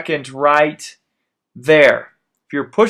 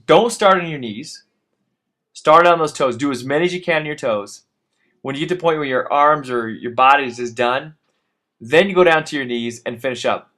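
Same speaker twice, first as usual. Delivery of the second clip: usual speaking voice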